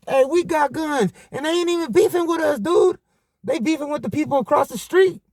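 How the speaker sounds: calm the uncle down, amped; high excited reassurance. Retold or imitated. Nephew telling the crawling uncle they're armed, high and urgent.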